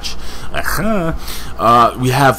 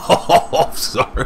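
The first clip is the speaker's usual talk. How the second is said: breaking into laughter on the words; helpless laugh, voice falling away; laughing apology; 3 semitones higher, much slower than usual. Real, not acted. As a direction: laugh through a sorry. Cracks up at own name gag.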